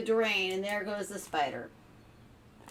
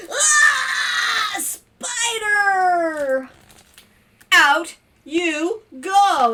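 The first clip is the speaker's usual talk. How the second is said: high, loud shriek into a drawn-out order; mock horror, then banish it loudly; storybook scream; 11 semitones higher, 11 dB louder, much slower than usual. Family at dinner spotting the web, hammed-up scream.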